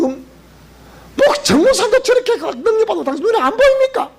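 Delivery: high, sharp scolding wife voice; wife's shrill nagging. Retold or imitated. Wife scolding pastor to go fast again, high nagging. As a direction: push him out the door with a nag. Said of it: "그러더래, 그러더래".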